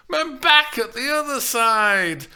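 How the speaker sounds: high, loud groaning complaint; groaning nurse. A narrator's reading. Old nurse groans about her back to Juliet. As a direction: groan it, hand on the aching back. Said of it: "Lynn groaned".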